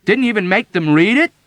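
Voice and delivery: loud, high, exasperated demand; angry distress. Acted. Yossarian stamping about, angrily questioning the old woman. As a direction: bark it out in distress. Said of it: "stamping about in anger and distress".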